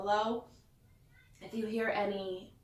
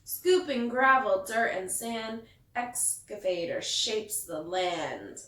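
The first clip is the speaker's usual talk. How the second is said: lively picture-book read-aloud; storyteller reading to kids; reading to children; 6 dB louder than usual. A narrator's reading. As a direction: read it like bedtime story time.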